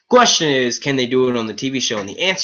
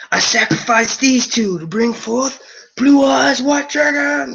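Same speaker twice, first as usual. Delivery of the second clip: high, slow dramatic summoning call; Yu-Gi-Oh duelist summoning; 12 semitones higher, slower than usual. Acted. Gamer summoning a dragon in Yu-Gi-Oh, hammed-up duelist call. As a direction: declaim it like a card-game duelist, stretching the last word.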